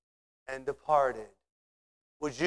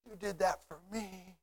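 soft, overcome wonder; hushed awe; 4 semitones higher, 11 dB quieter than usual. Acted. Worshipper marvelling before the Lord, hushed awe. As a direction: drop right down, almost to yourself. Awed words after shouted praise.

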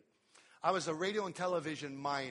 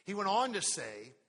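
normal talking voice

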